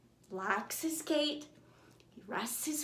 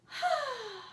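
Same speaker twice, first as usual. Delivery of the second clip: sleepy sigh; 11 semitones higher than usual. Acted. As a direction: sigh like settling into bed. Truck tucks in sleepily.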